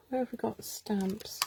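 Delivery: whispered to herself while rummaging. Real, not acted. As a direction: breathe the question without voice. Muttering to herself while searching.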